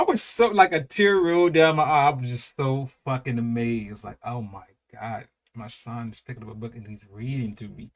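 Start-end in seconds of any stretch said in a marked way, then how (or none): 4.2-7.9 soft, emotional inner voice; moved and hushed. Real, not acted. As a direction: murmur it like you're holding back tears. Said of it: "I'm getting emotional".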